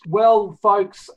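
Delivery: normal talking voice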